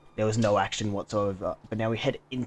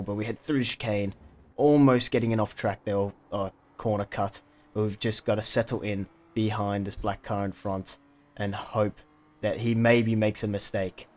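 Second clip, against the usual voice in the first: ordinary talk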